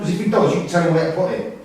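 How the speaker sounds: loud, fast warning; angry threat. Acted. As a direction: snap it out, fast and hard. Threatening the rival.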